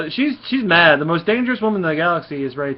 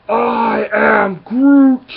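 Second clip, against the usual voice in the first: slow, booming, much higher Groot voice; Groot impression; 7 semitones higher, 9 dB louder, much slower than usual. Vlogger revealing Groot's surprise entrance, slow booming Groot voice. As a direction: stretch out each word like a giant tree creature. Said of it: "guess who it was".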